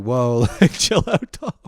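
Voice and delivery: high, laughing chill-out plea; calm him down with a laugh; mellow bystander. Retold or imitated. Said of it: "everyone's just kinda like".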